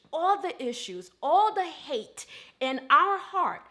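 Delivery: slow, weighty scripture preaching; emphatic preaching. Real, not acted. Preacher paraphrasing Matthew on the Father's gifts, slow emphatic preaching. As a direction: stretch the key words with a preacher's conviction.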